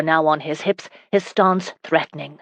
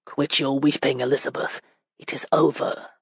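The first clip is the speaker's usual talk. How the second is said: much softer, lower comfort; 4 semitones lower, 6 dB quieter than usual. A narrator's reading. Husband softly comforting his weeping wife. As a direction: soothe her gently, much softer. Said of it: "said in a softer voice".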